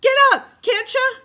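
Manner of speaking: very high, very loud shriek; a boy shrieking at his sister on the pavement; frantic scream. A narrator's reading. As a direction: scream it with everything. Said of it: "Jem was screaming".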